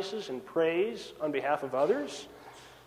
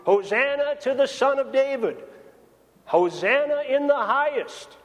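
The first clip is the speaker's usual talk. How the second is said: mild mock crowd shout; 5 semitones higher than usual. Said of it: "the crowds came out with palm branches shouting".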